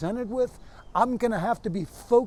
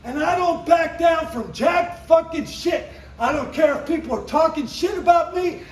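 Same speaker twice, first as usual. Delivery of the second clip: high, loud, punched-out stage tirade; shouted stand-up rant; 9 semitones higher, 7 dB louder than usual. Acted. Comedian signing off to a crowd, defiant shouted rant. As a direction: belt it at the crowd, hammering every swear word.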